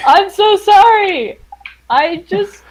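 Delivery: pleading apology. Acted. Player apologizes to the angry bunny.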